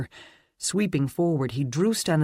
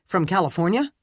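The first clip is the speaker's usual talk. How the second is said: higher, louder startled question; surprised; 5 semitones higher, 5 dB louder, faster than usual. A narrator's reading. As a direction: ask it in disbelief. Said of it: "surprise entering his voice".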